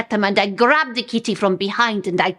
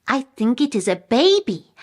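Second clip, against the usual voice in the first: ordinary delivery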